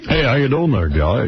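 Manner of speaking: lightly put-on radio host voice. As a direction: play at being a slick radio announcer. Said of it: "he has that radio voice".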